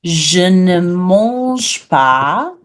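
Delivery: very slow, drawn-out French for learners; slow model phrase. Shown as a demonstration. Teacher tells non-meat eaters what to say, slow model phrase. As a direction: stretch each word of the French phrase. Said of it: "You'll say".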